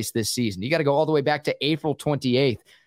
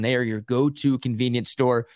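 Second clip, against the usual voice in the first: ordinary talk, nothing marked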